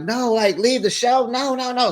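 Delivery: high, incredulous no; shocked disbelief. Retold or imitated. Reacting to the husband telling her to leave the show, shocked. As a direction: react as if that's absurd. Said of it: "And I was like".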